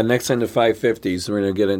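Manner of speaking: ordinary talk, nothing marked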